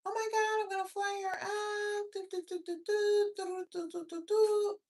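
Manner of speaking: high, giddy mock man bragging; mocking the guy. Mocking a man excited to fly a girl out, high giddy voice. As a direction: squeal it like a smug guy showing off. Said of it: "He to like".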